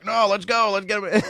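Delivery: high, loud, eager push; eager drunk buddy. Retold or imitated. Dad's tipsy doctor buddy eagerly insisting on pulling the stitches.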